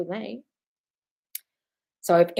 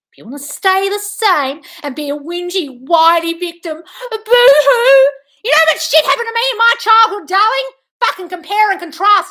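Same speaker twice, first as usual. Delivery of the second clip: real yelling; 9 semitones higher, 11 dB louder than usual. Real, not acted. Host yelling at a whiny viewer, furious high rant. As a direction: scream it at them, stretch the boo-hoo.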